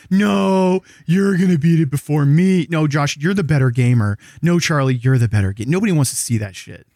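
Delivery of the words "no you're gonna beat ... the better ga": high, gushing fake modesty; overly sweet, passing the compliment back; fawning mock politeness. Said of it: "We're all gonna be like".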